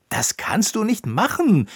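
a high, loud protesting cry; exclaiming. Acted. Koníček protests to Roppucher, loud high cry. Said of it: "rief Koníček".

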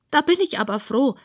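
high-voiced storybook pig, relieved; high pig voice, glad. A narrator's reading. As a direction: say it with relief in a piggy voice.

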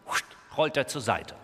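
plain reading voice

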